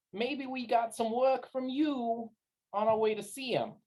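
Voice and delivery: apologetic streetwise patter; backpedal, then angle casually for work; folksy wise guy. Acted. Galan asks recruiter for work, folksy and placating.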